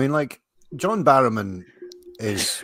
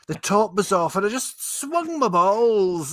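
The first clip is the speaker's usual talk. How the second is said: high hammed-up Scottish accent; mock Scottish Barrowman; 9 semitones higher than usual. Riffing as Barrowman on the willy scandal, mock Scottish. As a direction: camp Scottish ranting, played for laughs. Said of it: "John Barrowman's become Billy Connolly there".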